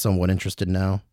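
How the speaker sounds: ordinary talk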